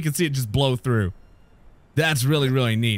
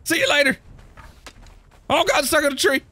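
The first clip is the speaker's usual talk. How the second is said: panicked fleeing; 10 semitones higher than usual. Real, not acted.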